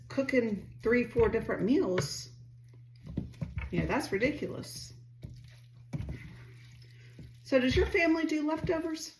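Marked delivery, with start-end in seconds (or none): none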